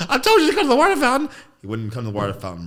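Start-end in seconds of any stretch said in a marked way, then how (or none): 0.1-1.3 put-on fast, high, loud kid voice with a laugh; five-year-old self. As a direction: rattle it off like an excited little kid. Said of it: "I said".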